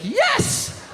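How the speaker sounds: high squeaky cheer; a little yelp of joy; pumping a fist at the news; excited yes. Retold or imitated.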